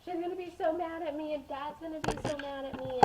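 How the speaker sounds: put-on high, loud worried child's wail; panicked child. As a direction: panic like a kid who just dropped the plate. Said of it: "I was so upset and I was so sad".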